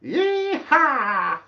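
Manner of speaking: high, hammed-up cowboy holler; playful mock cowboy yell; cowboy yeehaw. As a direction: whoop it like a rodeo cowboy. Cowboy greeting from chat.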